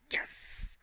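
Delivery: whispered yes, kept quiet; hushed secret celebration. Retold or imitated. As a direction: cheer under your breath. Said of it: "In secret".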